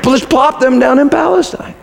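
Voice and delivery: high-pitched, exclaiming preacher's rant; incredulous excitement. Real, not acted. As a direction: rattle out the absurd miracle, pitched high. Preacher imagines an absurd miracle.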